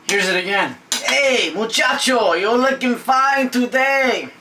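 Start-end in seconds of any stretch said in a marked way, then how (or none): 1.1-4.3 put-on high, drawn-out Spanish-accented flattery. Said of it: "Hey, senor".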